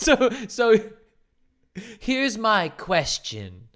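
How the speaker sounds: slow, hammed-up mock suspense line with a laugh; mocking coach. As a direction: parody the dramatic coach line, stretching it out. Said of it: "mocking the technique again".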